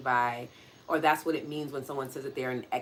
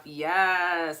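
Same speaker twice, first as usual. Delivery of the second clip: usual speaking voice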